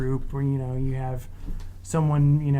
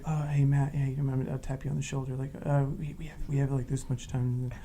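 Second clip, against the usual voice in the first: quiet; 8 dB quieter than usual. Retold or imitated. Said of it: "me being like".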